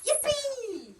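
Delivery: high gleeful whoop. Acted. Cousin cheering that Mitzi comes along, whooping. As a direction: cheer like a delighted child. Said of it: "Her cousin whooped".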